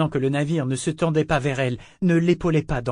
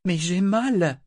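higher, anguished protest; pained outburst; 6 semitones higher, 4 dB louder, slower than usual. A narrator's reading. Althea protests her pain to the ship, pained outburst. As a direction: let the hurt spill out, rising. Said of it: "Les mots jaillissaient d'elle comme le sang".

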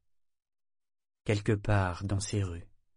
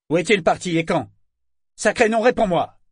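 much higher, loud raging yell; furious shout; 9 semitones higher, 8 dB louder than usual. Acted. Kyle raging at the figurehead, furious shouting. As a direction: storm at her with rage. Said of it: "tempêta Kyle".

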